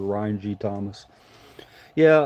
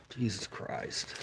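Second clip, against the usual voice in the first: muttered under breath; 13 dB quieter, much slower than usual. Real, not acted. Dog is wet.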